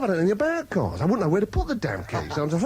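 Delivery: normal talking voice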